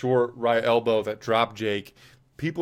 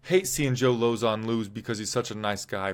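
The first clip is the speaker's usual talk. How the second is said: ordinary talk, nothing marked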